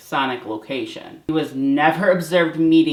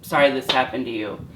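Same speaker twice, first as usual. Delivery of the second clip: normal talking voice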